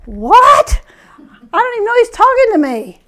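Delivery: high, incredulous retold reaction; baffled disbelief. Shopper reacting to a stranger's yell, high bafflement.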